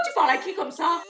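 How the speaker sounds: normal talking voice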